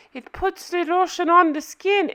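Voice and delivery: high-pitched creepy movie-villain quote; Buffalo Bill. Acted. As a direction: imitate the film villain's odd voice. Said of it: "oh gosh, Buffalo Bill".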